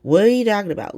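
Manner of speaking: high-pitched baffled question, no stretch on "What"; retold bafflement, said straight through; incredulous repeat. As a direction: ask it in evenly paced mock disbelief. Same question repeated without the stretch.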